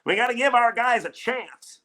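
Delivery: a hint of a hesitant team boss; half-hearted mock of the president's excuse; Scott Harris voice. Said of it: "I don't know why I'm doing that voice".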